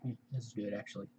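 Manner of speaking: talking while chewing food; quiet words around a mouthful of food; eating while talking. Real, not acted. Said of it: "Sorry I'm eating in front of you guys".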